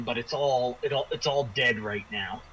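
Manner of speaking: ordinary talk, nothing marked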